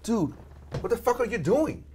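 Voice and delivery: imitating an intense friend; retold confrontation. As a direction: act out a friend getting in your face. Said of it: "you came up to me like".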